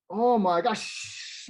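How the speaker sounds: slow, drawn-out hiss. A narrator's reading. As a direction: hiss it slowly under your breath. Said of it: "Marshall hissed".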